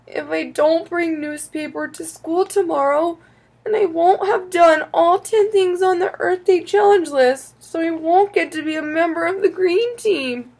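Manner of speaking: put-on high-pitched, pleading child's voice; upset child. A narrator's reading. Child fears missing the green team, upset. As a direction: spill out the worry in a high voice.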